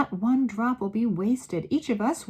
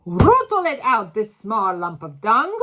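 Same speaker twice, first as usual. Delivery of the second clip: loud, higher screeching order; 3 semitones higher, 11 dB louder than usual. A narrator's reading. Grand High Witch screeching at the assembly. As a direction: screech it in contempt. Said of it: "screeched the Grand High Witch".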